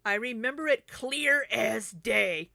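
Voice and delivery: loud, slow, hammered-out accusation; emphatic angry read-out. Retold or imitated. Vlogger reading Alexis's reply to Emily, loudly indignant. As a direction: read the accusation slowly and forcefully.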